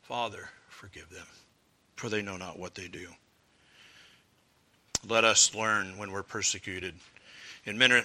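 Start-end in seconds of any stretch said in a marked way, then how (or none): 0.0-3.1 soft, quieter plea for mercy; hushed prayer. Acted. Stephen being stoned, praying for his attackers, hushed. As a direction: let the prayer sink to a gentle hush.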